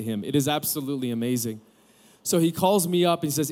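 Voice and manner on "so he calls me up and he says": ordinary delivery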